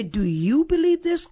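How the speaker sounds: "you" lifted high in the question; stress on YOU. Shown as a demonstration. Preacher offers one way Jesus asked it, stress on YOU. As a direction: raise the pitch on "you" to make it personal. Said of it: "with the emphasis being on you".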